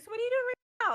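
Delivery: high, loud mock-casual question; retold attitude. Character grilling her boyfriend in game, with retold attitude. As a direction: pitch it way up, prying with attitude. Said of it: "why are you with the attitude".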